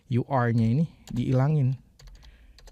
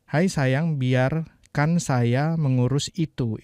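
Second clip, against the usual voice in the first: literal version; much slower than usual. Shown as a demonstration. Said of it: "Itu terjemahan kata per katanya ya".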